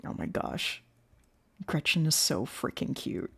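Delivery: usual speaking voice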